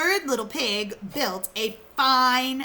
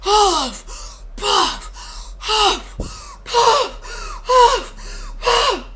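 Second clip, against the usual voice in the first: high, loud blowing words; playful loud huffing; huffing and puffing; 11 semitones higher, 6 dB louder, much slower than usual. Acted. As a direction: puff hard at the house. Wolf huffing at brick house.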